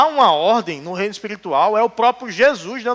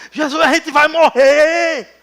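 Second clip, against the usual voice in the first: panicked disciples; 7 semitones higher than usual. Retold or imitated. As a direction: wake him in a panic. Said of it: "Mas quando".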